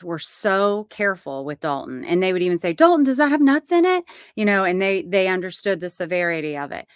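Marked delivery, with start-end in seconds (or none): 2.7-4.0 quick, higher, louder concerned question; caring teacher check. Retold or imitated. Teachers asking Dalton about nuts, quick and concerned. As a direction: ask like a careful teacher checking food.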